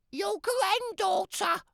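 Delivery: put-on wolf faking a little girl's voice; a put-on squeak to fool granny; squeaky fake girl. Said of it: "I squeaked".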